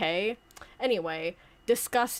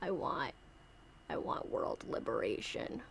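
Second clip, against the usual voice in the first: low whispered puppet voice, much softer; sinister puppet whisper; 10 semitones lower, 12 dB quieter than usual. Pretend. Puppet whispering its wish to Santa. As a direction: breathe the wish like a tiny overlord.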